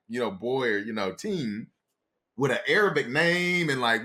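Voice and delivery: normal talking voice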